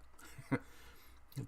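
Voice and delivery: breathy, high little giggle; airy giggle. Real, not acted. Vlogger giggling about swearing in French, airy and high. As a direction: giggle at your own naughtiness. Laughs after mentioning swearing.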